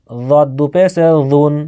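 ordinary talk

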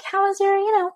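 put-on high cooing question to the baby; baby talk. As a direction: ask it sing-song and high, like talking to a toddler. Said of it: "I'm like".

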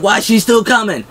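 high, insistent yelling; mock yell. Shane proving walker is dead, high mock yell.

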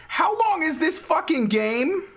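exasperated whine. Real, not acted. To chat about the endless game, high whining. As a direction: whine it out, pitch way up. Frustrated at long horror game.

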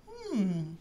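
puzzled, mysterious storybook voice; a musing hmm, then intrigued wondering. A narrator's reading. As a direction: wonder aloud with a touch of mystery. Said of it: "in a mysterious voice".

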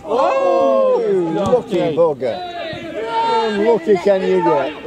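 loud disbelieving exclamation; yelling from the sidelines. Real, not acted. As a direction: call out at the lucky shot. Exclaiming at lucky goal.

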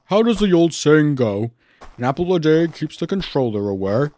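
teasing, put-on character voice; jovial, knowing delivery; Gordon. A narrator's reading. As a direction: set up a joke with relish. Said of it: "winked Gordon".

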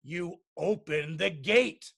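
slow, heavy father's scolding voice; angry father. A narrator's reading. Father blames Megan for the pigs, slow stern scolding. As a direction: scold slowly, word by word. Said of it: "yelled her father".

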